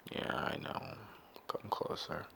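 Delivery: eerie whispered reply. Acted. Answering himself like a crazy man, whispered. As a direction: whisper to yourself, drawing in. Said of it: "I talk to myself a lot".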